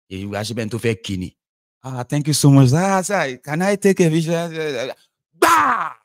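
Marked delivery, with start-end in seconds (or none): 1.8-4.9 high, loud, gushing flattery; fawning fan. Acted. A fan fawning over a politician, high and loud. 5.4-5.9 loud mock smack. Sound of a sudden blow.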